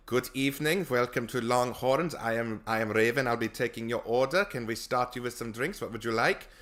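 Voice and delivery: put-on German-accented waiter. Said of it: "as a German".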